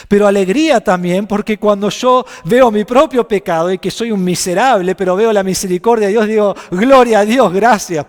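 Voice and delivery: loud, high, ringing praise; fervent preacher crying out thanks; preaching at full force. Acted. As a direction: burst out in joyful praise to God. Preaching climax on joy.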